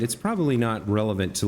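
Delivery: ordinary delivery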